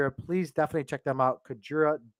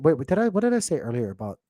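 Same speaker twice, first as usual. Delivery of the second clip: normal talking voice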